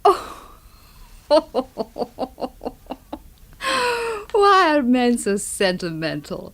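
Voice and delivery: laughing it off, slow and teasing; amused laugh. Acted. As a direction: brush off the compliment with a laugh. Laughs off a tender compliment.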